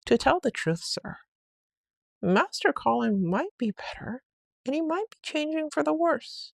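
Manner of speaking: a hint of a worried servant; housekeeper. A narrator's reading. Housekeeper reporting to her master, lightly worried.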